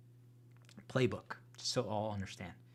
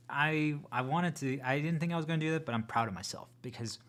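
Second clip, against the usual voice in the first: louder and higher, proud and excited; 4 semitones higher, 7 dB louder than usual. Real, not acted. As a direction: burst out proud of yourself. Excited self-praise.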